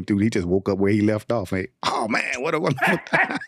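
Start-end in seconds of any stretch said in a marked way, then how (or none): 1.8-3.4 high-pitched, laughing exclamation; mock blissful revival. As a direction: playfully imitate a jumper waking up delighted. Co-host mimics the revived jumper.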